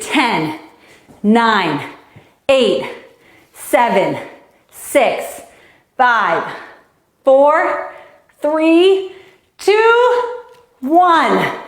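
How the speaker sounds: rising countdown building. Real, not acted. Coach counting kids down, building excitement. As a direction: climb in pitch toward one.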